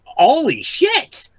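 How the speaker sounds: loud, high, drawn-out amazement; stunned exclamation. Real, not acted. Player reacting to a very good roll, loud amazement. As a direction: exclaim it slowly in shock.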